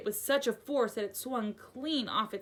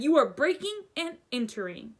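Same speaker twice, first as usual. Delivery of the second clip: a hint of a pompous uncle; mildly blustering uncle; indignant uncle; 6 semitones higher, 5 dB louder than usual. A narrator's reading. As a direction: accuse him huffily. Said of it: "he said".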